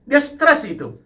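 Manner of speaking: usual speaking voice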